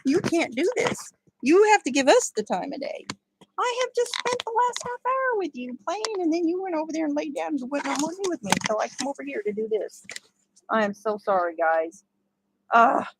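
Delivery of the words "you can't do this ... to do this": lightly voiced jealous dogs, higher; voicing the dogs. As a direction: speak for the poodles, a bit higher and whiny. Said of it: "They're like".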